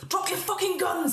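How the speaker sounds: high, strained mock scream; screamed threat. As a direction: scream it high without real volume. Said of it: "He screams at you".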